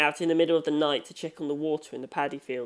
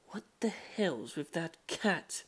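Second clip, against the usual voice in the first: slow whispered mutter, much softer; hushed, unvoiced mutter at the strange cat; 11 dB quieter, slower than usual. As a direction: mutter it under your breath in disbelief. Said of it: "Kishibe Rohan muttered".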